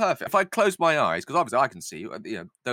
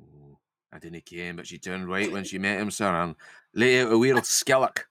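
put-on vague northern accent; 4 semitones lower than usual. Lizzie recounts Brianna's fright, wandering northern accent. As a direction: keep up a badly faked northern Lizzie. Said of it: "go for a vague northern accent".

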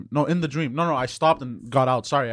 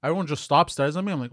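ordinary talk

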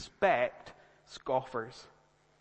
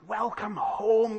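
big, drawn-out, high welcome; imagined excited welcome; 6 semitones higher, 6 dB louder, much slower than usual. Pretend. Passport officer greeting a returning traveller, hammed-up welcome. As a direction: stretch out a loud, hammed-up greeting. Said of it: "I was really excited".

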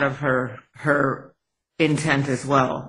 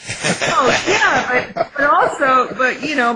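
excited laughing; 10 semitones higher, 5 dB louder than usual. Real, not acted. Laughing reaction to Clint Eastwood.

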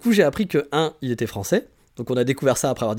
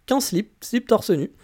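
higher, slowed, each piece of "slip torse nu" set apart; incredulous emphasis; 6 semitones higher, much slower than usual. Real, not acted. Vlogger describing a man in a café, slow incredulous emphasis on "slip torse nu". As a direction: spell out "qu'en slip" slowly in disbelief. Stressing the shocking state of undress.

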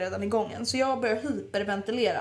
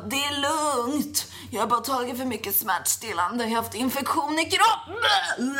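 high, slow, drawn-out put-on voice; hammed-up sick man insisting he's fine; mock reassuring passenger; 4 semitones higher, slower than usual. Imitates sick man reassuring the crew.

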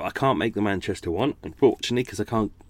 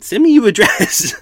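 exasperated retort; 10 semitones higher, 9 dB louder than usual. Retold or imitated. Exasperated reply to patrons.

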